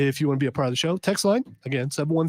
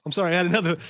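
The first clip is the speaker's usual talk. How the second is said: ordinary delivery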